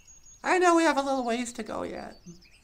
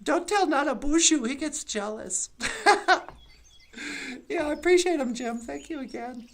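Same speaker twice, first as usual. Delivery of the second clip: ordinary delivery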